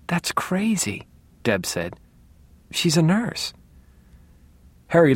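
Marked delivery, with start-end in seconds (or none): 0.1-1.1 high, slow disbelief; incredulous. A narrator's reading. Sister hears the nurse is overdosing father, incredulous. 2.7-3.5 higher-pitched, naive protest; incredulous. A narrator's reading. Sister defends the nurse to her brother, incredulous.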